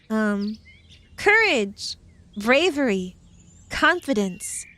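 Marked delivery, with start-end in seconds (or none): none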